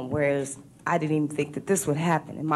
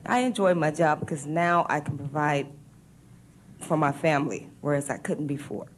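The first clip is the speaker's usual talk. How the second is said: ordinary talk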